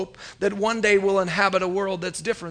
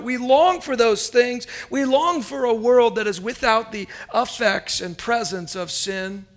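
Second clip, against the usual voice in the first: ordinary delivery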